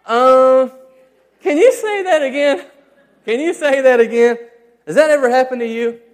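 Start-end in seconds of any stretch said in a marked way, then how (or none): 0.0-4.4 higher, louder mock sheepish plea; hammed-up guilty son asking again. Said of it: "To which I reply".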